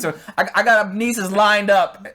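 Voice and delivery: breezy heartless father; callous dad. Retold or imitated. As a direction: toss it off, careless and loud.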